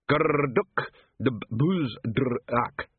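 halting, very slow foreign words; laboured attempt. A narrator's reading. Angua trying out Dwarfish for Carrot, laboured and halting. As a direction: struggle through each difficult syllable. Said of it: "she managed".

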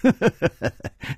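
breathy little chuckle; a small smiling chuckle; polite chuckle; soft laugh. A narrator's reading. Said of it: "微笑着说".

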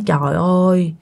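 usual speaking voice

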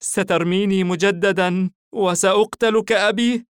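put-on high, loud questioning child's voice, mildly weary. A narrator's reading. Orphaned child asks his rescuer about being abandoned, high and mildly weary. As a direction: voice a tired little boy, pitched well up. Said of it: "أمال الطفل برأسه بتعب وقال".

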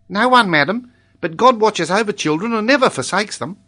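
put-on high, loud, devout child; Tolini. A narrator's reading. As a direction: declare it earnestly as an accented little boy.

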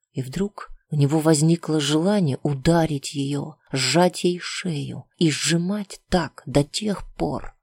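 ordinary delivery

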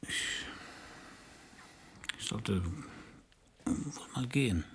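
slow, hesitant whisper. Acted. Detective reluctantly telling his lover he should leave, slow whisper. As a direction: half-heartedly murmur that you should go. Hesitant leaving in intimate scene.

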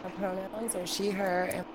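faint and distant, cutting out; far from mic. Real, not acted. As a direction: voice off-mic and thin. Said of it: "[name], come closer to the mic".

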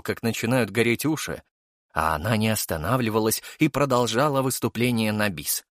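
usual speaking voice

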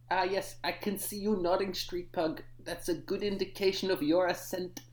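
usual speaking voice

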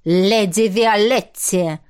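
slow, loud warning growl. Acted. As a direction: snap it out as a rebuke. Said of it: "рыкнул принц".

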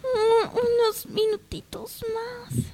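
soft, slow, whispered plea from bed; sleepy whisper. Acted. Sleepy girl begs to stay in bed. (susurro somnoliento, lento y suplicante)

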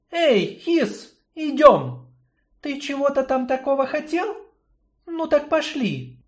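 high woman's voice calling out. Acted. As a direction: beckon him along. Yarina calls the snake.

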